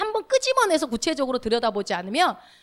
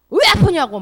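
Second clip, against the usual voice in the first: mock angry snap; 5 semitones higher, 8 dB louder than usual. 아픈 사람에게 화내는 장면.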